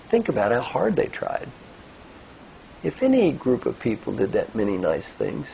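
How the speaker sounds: ordinary delivery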